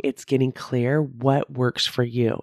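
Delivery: ordinary delivery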